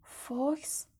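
soft, hushed single word; whispered under breath. A narrator's reading. Harry whispering the phoenix's name in awe. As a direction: breathe the name quietly, barely audible. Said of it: "هری زیر لب آهسته گفت".

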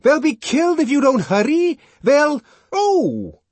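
very high, loud frantic warning; desperate shriek of alarm; panicked yelling. A narrator's reading. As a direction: beg them to hurry, breaking off in a cry. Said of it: "yelled Bernard".

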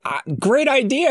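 high, mock-bright "great idea"; sarcastic. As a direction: say it with heavy sarcasm. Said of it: "And I was like".

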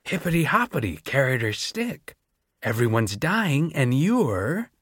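deranged doctor chanting at the students; crazy singsong. A narrator's reading. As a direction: tease like a nursery rhyme gone wrong. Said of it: "so crazy".

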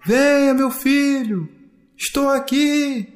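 high, slow, long-held call; distant, drawn-out father's call; calling from afar. A narrator's reading. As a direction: beckon your child from far away. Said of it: "só que mais longe".

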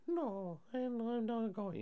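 high, soft voice of refusal; small, thin mock grandma voice. Retelling grandma refusing to leave with the family, soft and high. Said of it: "the grandma's like".

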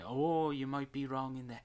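hammed-up bad Irish, opening on a long "oh"; terrible Irish accent. Host starts mimicking the redhead's line, terrible Irish accent. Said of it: "the way she says it".

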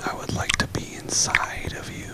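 hushed sultry whisper; ASMR whisper. Pretend. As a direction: breathe the words softly. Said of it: "a little A S M R".

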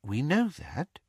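softly, a calm father's voice; quiet. A narrator's reading. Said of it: "said Mister Weasley quietly".

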